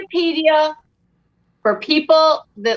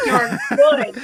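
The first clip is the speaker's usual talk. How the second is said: laughing affirmation; slower than usual. Pretend. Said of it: "You're like".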